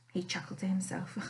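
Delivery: ordinary delivery, nothing marked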